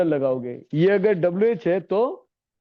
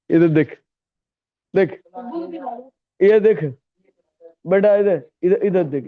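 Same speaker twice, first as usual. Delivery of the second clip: calling out; 5 dB louder than usual. Real, not acted. Teacher calls inattentive student.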